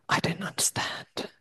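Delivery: unvoiced, lost little whisper; confused whisper. Newcomer facing cultural differences, confused whisper. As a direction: breathe it out, bewildered.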